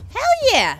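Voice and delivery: loud, high whoop of victory; cheering a successful craft; triumphant cheer. Real, not acted. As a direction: celebrate the win out loud.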